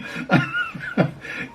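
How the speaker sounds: a short high surprised laugh; amused laugh. Real, not acted. Grandpa laughs after the button string breaks, amused laugh. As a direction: laugh at your own mishap.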